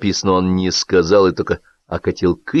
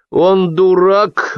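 put-on slow, loud drunken blurt; blurting drunk anger; 11 semitones higher, 5 dB louder, much slower than usual. A narrator's reading. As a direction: drunk guest blurts out at the table, angry. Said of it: "выпалил окончательно обозлённый и пьяный Кытин".

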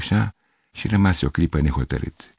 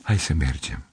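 calm gentle reassurance; 4 semitones lower, 5 dB quieter than usual. A narrator's reading. Girl calmly answers.

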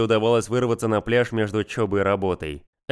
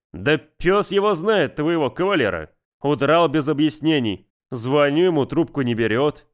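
irritated; 4 semitones higher than usual. Acted. Man grumbling about absent friend.

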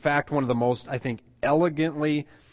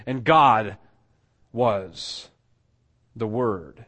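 GOD put up front and hit louder, then pauses; stress on GOD; 4 dB louder, much slower than usual. Shown as a demonstration. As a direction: punch GOD, then slowly set down was, the Word. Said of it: "it's emphatic in the position".

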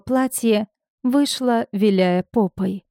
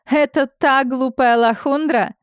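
higher, scornful put-on voice; mocking rival; 5 semitones higher than usual. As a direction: sneer the question at the wife. Rival mocks the wife. (насмешливо, с издёвкой)

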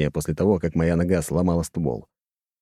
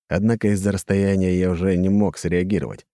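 ordinary delivery

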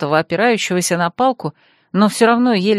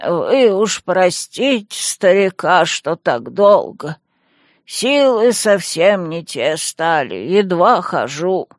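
put-on slow, frail old caretaker; old man voice; slower than usual. A narrator's reading. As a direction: speak like a tired, creaky grandpa.

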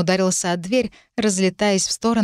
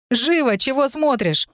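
higher-pitched, teasing order; bossy with wry amusement; wry command; 7 semitones higher, slower than usual. A narrator's reading. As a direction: hurry them along with a smirk. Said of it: "криво усмехнулся он".

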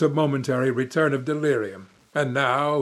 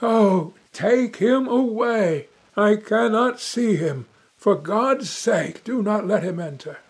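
higher and slow, pleading in terror; a horrified plea, higher and drawn out; agonized cry; 7 semitones higher than usual. A narrator's reading. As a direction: beg them to keep him away, anguished. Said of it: "cried out in agony".

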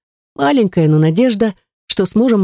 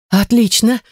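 lifted, higher-pitched relief; cheered up; 6 semitones higher, slower than usual. A narrator's reading. Heroine hearing Gerta has an artifact, cheered up. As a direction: perk up, pitch rising. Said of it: "воспряла духом я".

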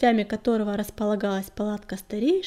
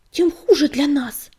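higher, louder angry burst; outburst; 4 semitones higher, 8 dB louder than usual. Acted. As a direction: explode with alarm. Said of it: "взорвалась Рябинка".

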